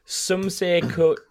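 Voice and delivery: normal talking voice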